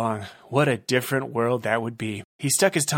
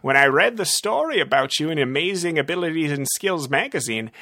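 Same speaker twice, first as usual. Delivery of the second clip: creepy villain; 7 semitones higher than usual. Acted. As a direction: relish every word like a creep. Villain speaks.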